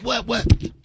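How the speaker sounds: sharp, loud, breathy "What?"; hyped yell after a great set; pumped-up shout. Retold or imitated. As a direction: bark it out, hyped off stage. Pumped up after killing onstage.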